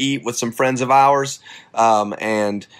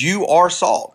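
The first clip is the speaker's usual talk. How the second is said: "are" punched higher with a falling tune; stress on are; 6 semitones higher, slower than usual. Shown as a demonstration. Preacher declaring the verse with weight on "are". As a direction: hit "are" hard and let it drop. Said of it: "He says".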